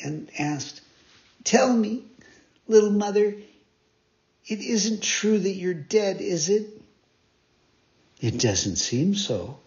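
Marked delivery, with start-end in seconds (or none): 1.4-6.8 higher, pleading question; hopeful puppet. Acted. Pinocchio begging his little mother, higher and pleading. 8.2-9.6 lower, slow, gently amused; smiling reply. A narrator's reading. Fairy smiling at Pinocchio, low and slow. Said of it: "answered the fairy, smiling".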